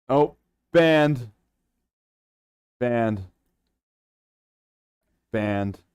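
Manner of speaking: mock-outraged yell of banned; loud exasperated cry; 1 shout. Real, not acted. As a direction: bellow the word in disbelief. Gets banned, exclaims.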